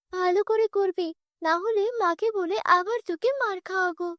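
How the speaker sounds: put-on high, bossy young girl; spoiled stepsister. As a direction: order the maid around haughtily.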